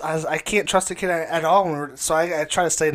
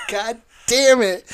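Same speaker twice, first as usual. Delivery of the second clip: mock angry groan, slow and high; retold frustration; 8 semitones higher, 5 dB louder, slower than usual. Retelling his anger after the friend knocked him off. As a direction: drag it out like a fed-up gamer. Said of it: "fucking pissed".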